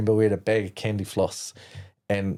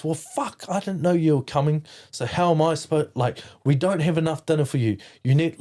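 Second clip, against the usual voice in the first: retold angry dad; 7 semitones higher than usual. Dad annoyed his son came for dinner, angry higher outburst. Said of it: "he got angry at".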